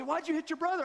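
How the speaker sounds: a hint of a cross mother asking why; mom scolding. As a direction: mom scolding her son, mild mock scold. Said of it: "she'd say".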